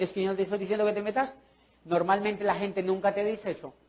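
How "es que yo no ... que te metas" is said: fast, off-hand repeat; rapid reply. Retold or imitated. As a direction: toss it off quickly. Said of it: "yo le puedo decir". (repetición rápida y despreocupada)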